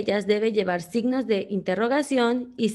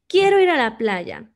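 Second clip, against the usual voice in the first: louder, eager, falling on "playa"; exclaimed; 2 semitones higher, 5 dB louder than usual. Shown as a demonstration. Teacher giving the answer, eager exclamation. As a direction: cry out "Quiero ir a la playa" with longing. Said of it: "Debe llevar signos de admiración".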